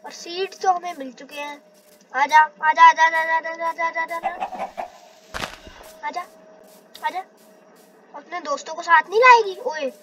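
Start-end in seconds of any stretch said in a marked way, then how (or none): 2.2-7.2 coaxing call. Real, not acted. Coaxing a chicken toward his farm, a calling voice that fades. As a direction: call the chicken in, then let it die away.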